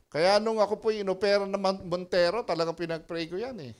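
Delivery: loud, high preaching push; fervent Tagalog sermon delivery. Real, not acted. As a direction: preach the testimony with force. Preacher testimony at full force.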